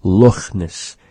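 Scottish Loch Ness, raspy ch at the back of the throat. Shown as a demonstration. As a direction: say Loch Ness like a Scotsman, ch rasped. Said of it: "heard a Scotsman pronounce Loch Ness".

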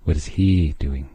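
normal talking voice

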